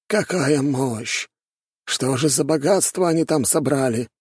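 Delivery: high, wondering elder; awed exclamation. A narrator's reading. Elder watches the tree blaze in the distance, awed.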